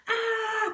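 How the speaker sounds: acted shriek of horror; high-pitched scream. A narrator's reading. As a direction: scream at the sight in the mirror, very high. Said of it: "they screamed in unison".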